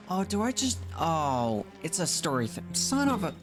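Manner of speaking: ordinary talk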